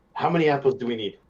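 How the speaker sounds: usual speaking voice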